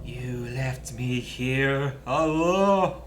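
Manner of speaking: slow eerie ghost voice. Acted. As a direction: drag it out, haunting. Ghost voice reading game line.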